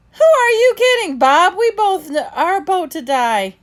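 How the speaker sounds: high, loud panicked cry; frantic shout. Acted. Sister, terrified in the flood, screams back at Bob. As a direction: yell it over the rising water.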